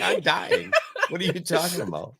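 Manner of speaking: squeaky high voice through laughter; laughing. Retold or imitated. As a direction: laugh through the line as the calm sheriff. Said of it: "He's like".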